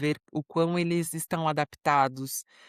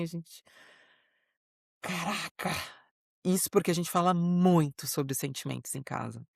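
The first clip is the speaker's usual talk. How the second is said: ordinary talk, nothing marked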